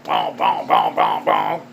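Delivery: very low, rhythmic booming thuds; imitated head-banging thuds. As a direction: go far down for each boom. Imitates banging heads on car.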